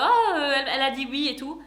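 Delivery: mock fiancé. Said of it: "en mode".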